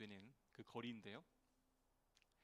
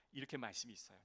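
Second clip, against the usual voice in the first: usual speaking voice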